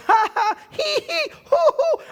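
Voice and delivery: ha-ha-hee-hee as a rising, giddy chuckle; acted belly laugh. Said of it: "starts chuckling this cosmic chuckle".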